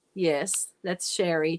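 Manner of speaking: ordinary talk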